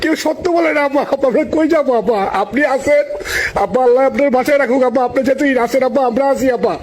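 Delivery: pleading, choked with feeling; emotional pleading. Real, not acted. Supporter pleads emotionally to leader.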